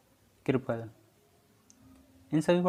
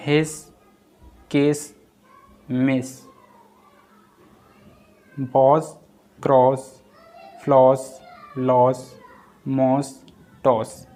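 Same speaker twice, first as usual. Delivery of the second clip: each word louder and set apart by pauses; loud word-list reading; 7 dB louder, slower than usual. Shown as a demonstration. Teacher to students, calling out the example words. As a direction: call out every example word clearly for the class.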